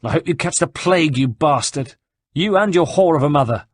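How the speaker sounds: high, loud furious curses; cursing rage. A narrator's reading. Rosa curses Antonio after the painful surgery, loud and high. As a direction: hurl the curse at him.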